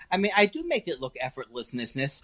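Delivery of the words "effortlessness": "effortlessness" with extra "ness" endings tacked on; mock tongue-twisted "effortlessness", stuttering out more endings; joking mispronunciation. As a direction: keep repeating the tail of "effortlessness". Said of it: "not to continue the joke".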